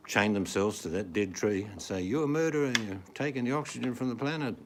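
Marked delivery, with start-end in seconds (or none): 2.0-4.6 high-pitched imitation of an accusing protester; mock accusing yell; mock protester shouting. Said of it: "will yell and scream and shout".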